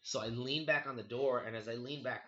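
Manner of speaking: usual speaking voice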